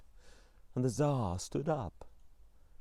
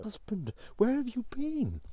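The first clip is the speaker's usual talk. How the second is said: Tsarina's worried voice; 10 semitones higher than usual. A narrator's reading. Tsarina anxiously greets her husband.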